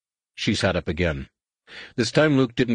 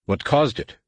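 a pointed demand, pitched higher; 7 semitones higher than usual. A narrator's reading. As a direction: press the droid for an answer. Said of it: "Luke demanded of the droid".

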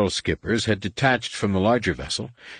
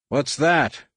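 much higher-pitched call; 8 semitones higher, 3 dB louder than usual. A narrator's reading. Han calls up to Leia after the bumps, calling out. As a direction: call a question up through the ship. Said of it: "Han called up".

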